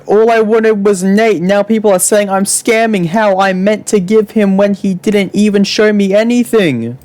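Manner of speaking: high-pitched agitated complaint; worked-up imitation, pitched well up; mock all-caps rant. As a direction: read it out like an all-caps message. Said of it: "Starting to go in all caps".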